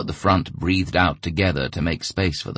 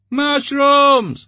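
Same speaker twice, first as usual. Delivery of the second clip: much higher, loud yelling in support; crowd yell; 9 dB louder than usual. A narrator's reading. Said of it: "the crowd yelled in support".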